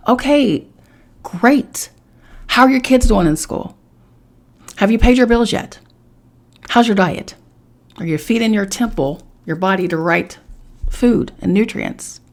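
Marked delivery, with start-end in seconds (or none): 0.1-7.3 sarcastic reply. Pretend. Mock reply to spiritual person.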